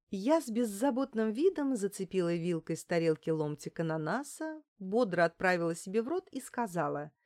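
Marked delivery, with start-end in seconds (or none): none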